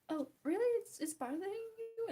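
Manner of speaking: high-pitched imitation of someone oblivious; mock innocent surprise. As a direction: play clueless, rising high in mock disbelief. Acting out an oblivious annoying person.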